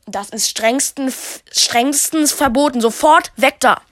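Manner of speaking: stern scolding, building to a loud order; angry shout. Acted. Guard catches kids touching dino poo, angry shout. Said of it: "schrie Bull wütend".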